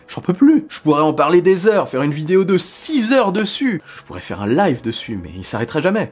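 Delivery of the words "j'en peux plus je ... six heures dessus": excited. Real, not acted. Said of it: "moi je suis à fond".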